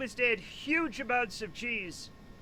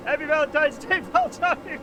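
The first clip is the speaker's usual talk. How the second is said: laughing; 5 semitones higher, 6 dB louder than usual. Real, not acted. Laughing exclaim.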